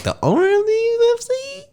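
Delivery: high squeaky astonished question; stunned disbelief. Retold or imitated.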